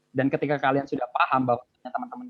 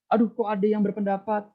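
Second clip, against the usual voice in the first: mock flustered surprise; 8 semitones higher than usual. Flustered speaker caught off guard.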